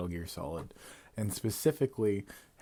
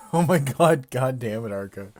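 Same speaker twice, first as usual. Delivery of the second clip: laughing outburst; 4 semitones higher, 17 dB louder than usual. Real, not acted. Laughing outburst at a chat joke.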